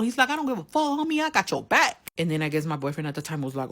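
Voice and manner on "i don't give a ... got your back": high, cocky reassurance; retold tough homie. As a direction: brush it off, backing her up. Homie's retold bold reply.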